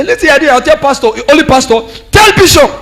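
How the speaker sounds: mocking retort. Wife's retort voiced.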